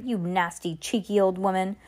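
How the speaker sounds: ordinary delivery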